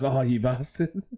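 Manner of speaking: mock accusing. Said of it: "And he's like".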